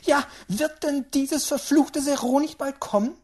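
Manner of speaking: loud, high ranting; angry old woman. A narrator's reading. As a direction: rant furiously as a stubborn great-aunt. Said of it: "zürnte die Alte".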